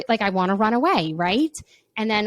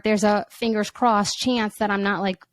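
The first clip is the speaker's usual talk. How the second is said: ordinary talk, nothing marked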